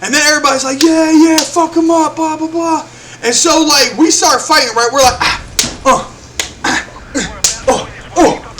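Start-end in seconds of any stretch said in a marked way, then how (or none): 0.8-2.9 higher-pitched mock cheering; mock rowdy chant, pitched up; retold crowd egging on. 5.2-8.6 short voiceless punch grunts; mock grunts of taking hits; imitated fight grunts. Acting out trading punches.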